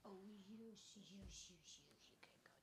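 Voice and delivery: soft unvoiced whisper to the dog. Real, not acted. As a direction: breathe it quietly.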